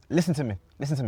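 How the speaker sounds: bossy lecturing elder. Retold or imitated.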